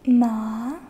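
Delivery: "Ma" dipping down, then back up. Shown as a demonstration. Teacher showing second tone to class, dipping-then-rising "Ma". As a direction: draw out a loud "Ma" that sags and climbs. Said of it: "dips down and then back up".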